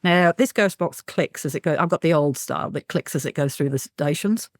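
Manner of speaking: normal talking voice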